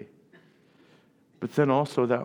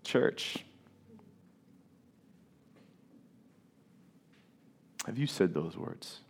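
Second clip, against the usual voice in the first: hushed question; 5 semitones lower, 10 dB quieter than usual. Real, not acted. Direct question to congregation.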